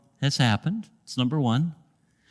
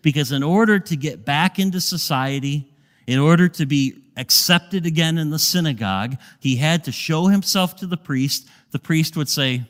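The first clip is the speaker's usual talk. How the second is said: normal talking voice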